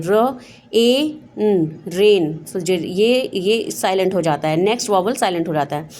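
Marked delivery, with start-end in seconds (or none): 0.0-2.4 र, ए, न, then a slow blended रेन; sounding out rain. Shown as a demonstration. Teacher showing two vowels together. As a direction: let रेन come out slowly after the sounds. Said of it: "उसकी sound ज़्यादा आएगी".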